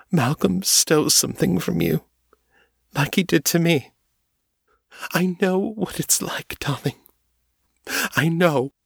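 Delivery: high, tearful, tender comfort; tearful consolation. A narrator's reading. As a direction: comfort her while still crying.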